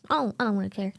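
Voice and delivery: normal talking voice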